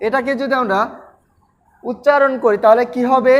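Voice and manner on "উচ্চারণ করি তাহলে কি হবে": normal talking voice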